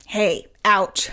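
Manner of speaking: mock an annoyed, prickly ouch; overdone irritated "Hey, ouch"; exaggerated irritated ouch. Shown as a demonstration. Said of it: "I'm exaggerating".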